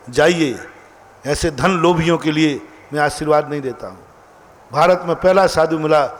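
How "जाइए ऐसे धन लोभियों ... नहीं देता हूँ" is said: ordinary talk, nothing marked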